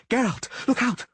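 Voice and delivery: high, loud panicked woman's cry; sorceress's warning scream. Acted. Yennefer warns Geralt of the falling block, high panicked scream.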